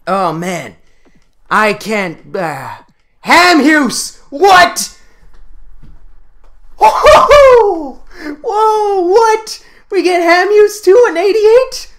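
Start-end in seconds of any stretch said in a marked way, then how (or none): 3.2-11.9 high, loud whoops of disbelief; astonished excitement. Real, not acted. Gamer pulling another top card, astonished and shrieking. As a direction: burst out whooping at a second jackpot. Second big card pulled.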